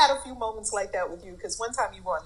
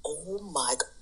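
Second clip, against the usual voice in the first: hushed stunned gasp of words; shocked whisper; 5 semitones lower, 9 dB quieter, slower than usual. Retold or imitated. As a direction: whisper it in disbelief. Her shocked reaction retold.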